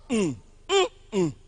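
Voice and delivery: teacher humming a middle-stressed word's rhythm; hummed stress pattern. Shown as a demonstration. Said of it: "just make the sound".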